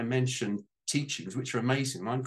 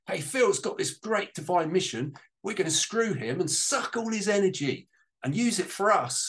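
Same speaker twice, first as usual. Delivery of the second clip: voicing the matrix; 8 semitones higher, 3 dB louder than usual. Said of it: "It was saying".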